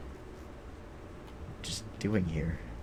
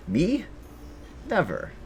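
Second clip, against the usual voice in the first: mock innocence; 10 semitones higher than usual.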